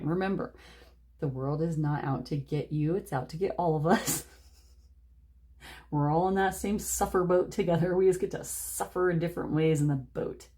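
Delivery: ordinary talk, nothing marked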